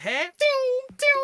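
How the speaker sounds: high, loud ting ting sound; hurried ting ting. Retold or imitated. Mocking busy Londoners, high quick ting ting. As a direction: chirp it like everyone's dashing past. Mimicking Londoners rushing about.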